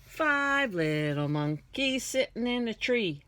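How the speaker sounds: usual speaking voice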